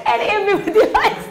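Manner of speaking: laughing loudly through the punchline; real laughter over the joke. Real, not acted. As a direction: giggle and laugh as you tell it.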